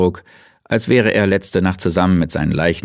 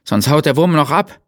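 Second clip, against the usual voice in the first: high and loud, still alarmed; shouted warning; 8 semitones higher, 4 dB louder than usual. A narrator's reading. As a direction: keep yelling, urgent. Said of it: "schrie Leitner".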